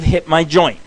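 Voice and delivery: normal talking voice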